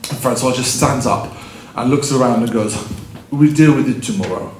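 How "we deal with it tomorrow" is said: imitated French accent; deadpan Frenchman brushing it off. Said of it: "with more of a French accent".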